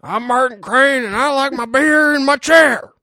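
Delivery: high, loud put-on grumpy old dad; a gruff father bragging about his beer chair; Martin Crane impression. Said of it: "He's like".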